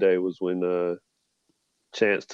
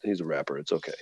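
much softer, lower soothing aside; gently calm mom down; soft reassurance; 7 semitones lower, 11 dB quieter, faster than usual. Retold or imitated. Reassuring mom in retold scene.

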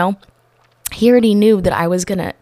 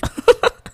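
high laugh; 4 dB louder than usual. Real, not acted. Laughing at own story.

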